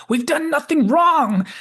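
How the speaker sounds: loud, high, drawn-out protest; hammed-up panicked self-defense; defensive cry. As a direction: wail it like an accused man. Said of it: "Zorn cries out defensively".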